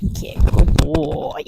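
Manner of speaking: slow, whispered praise for the dog; soft praise. Real, not acted. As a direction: gently tell the dog he's good. Praising dog after retrieve.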